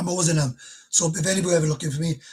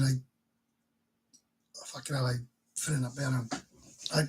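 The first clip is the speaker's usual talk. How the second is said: barely audible, voice catching on the memory; choked up; 5 semitones lower, 9 dB quieter, faster than usual. Real, not acted. Holding back tears, words fading. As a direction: tell viewers of the condo memories, choked up and softer. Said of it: "I'm getting quite emotional now".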